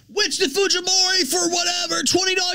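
high, mocking read of a donation. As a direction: squeak it out like a mocking parrot. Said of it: "And they're like".

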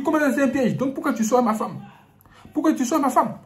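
high, loud accusing shout; angry husband. Acted. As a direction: demand it furiously. Husband accuses priest, acted quarrel.

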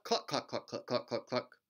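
clucking like a hen; chicken cluck. Shown as a demonstration. Teacher clucking like a chicken for kids. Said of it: "Can you cluck".

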